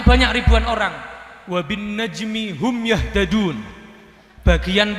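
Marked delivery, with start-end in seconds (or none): 1.5-3.6 slow, measured verse recitation; slow Quran recitation. Acted. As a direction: chant it slowly. Woman answers in Quran verse.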